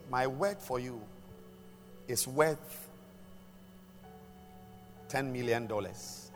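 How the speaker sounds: normal talking voice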